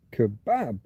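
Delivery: "Kebab" as kuh-BAB with a falling finish; stress on second syllable. Shown as a demonstration. Streamer practising the Arabic word, second-syllable stress. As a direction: punch the last syllable of "Kebab". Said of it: "stress this syllable".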